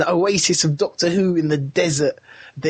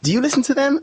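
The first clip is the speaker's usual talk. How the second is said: mock American girl, pitched higher; imitated American accent with lifted, questioning pitch; 5 semitones higher than usual. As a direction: put on an American accent for her. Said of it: "That was my American accent".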